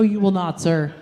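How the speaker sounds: ordinary talk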